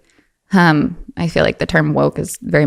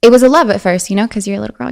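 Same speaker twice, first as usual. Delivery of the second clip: ordinary talk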